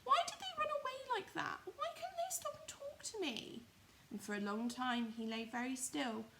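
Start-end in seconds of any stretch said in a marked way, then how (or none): 0.0-3.7 very high, forlorn toy rabbit wondering; squeaky lonely little rabbit. Acted. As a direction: ask it high and lost, to himself. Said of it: "he thought".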